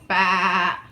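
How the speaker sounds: loud bleating baa; sheep baa. Shown as a demonstration. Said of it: "Sheep go".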